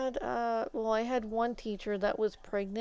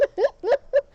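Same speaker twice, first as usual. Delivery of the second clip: loud high squeal of laughter; 10 dB louder than usual. Real, not acted. After ranting about French, a high squealing laugh. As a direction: burst into a shrieking giggle.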